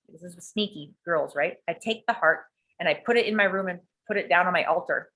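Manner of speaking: ordinary talk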